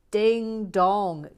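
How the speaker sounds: loud far sung ding dong; mock correct-answer chime; quiz chime. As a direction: mimic a game-show bell.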